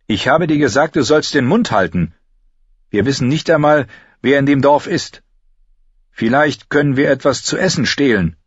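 lightly voiced annoyed leader; irritated Gendry. A narrator's reading. Gendry rebukes Lommi, irritated.

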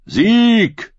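high, drawn-out rallying shout; like a call carried across a hall. A narrator's reading. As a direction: shout it through cupped hands. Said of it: "rief Rodrik der Leser, die Hände trichterförmig".

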